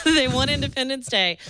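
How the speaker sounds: laughing. Real, not acted.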